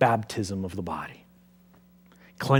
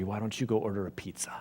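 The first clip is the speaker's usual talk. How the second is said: softer, breathy conspiratorial whisper; whispered joke; 3 semitones lower, 9 dB quieter than usual. Acted. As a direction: whisper the punchline like a secret. Jesus' joking aside to Jairus.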